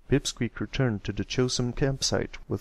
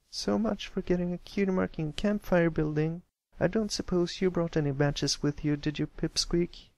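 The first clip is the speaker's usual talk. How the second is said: high, disappointed filly; sulk, then hope for a yes; Scootaloo, high grumbling voice; 8 semitones higher than usual. Acted. Scootaloo sulking then asking Pipsqueak for matches, high grumbling filly voice.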